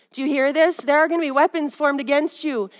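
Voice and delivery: normal talking voice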